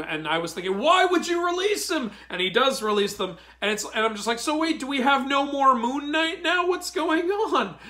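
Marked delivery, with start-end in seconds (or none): 0.8-2.1 incredulous high question. Real, not acted. Incredulous thought. 4.4-7.8 confused laughing question. Real, not acted.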